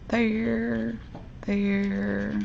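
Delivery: soft murmured there, there; murmured to herself. Real, not acted. Crafter murmurs to herself while dabbing glitter, softly. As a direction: say it under your breath while working.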